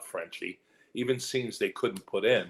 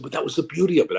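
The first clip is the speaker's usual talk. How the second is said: raised, louder emphatic delivery; emphatic retort; 7 semitones higher, 7 dB louder, faster than usual. Retold or imitated. Actor making his case to the director, emphatic. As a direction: push the point home, high and loud. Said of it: "I said it just like that".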